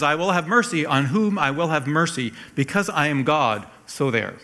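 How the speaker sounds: stern, emphatic verdict with no room for argument; somewhat frightening. Acted. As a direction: lay down the law as an almighty God. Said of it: "That sounds kind of frightening".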